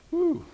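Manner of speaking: high whistled whew of dismay; startled whew. Pretend. Believer hearing a hard word, high startled whew. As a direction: let out a high surprised whew.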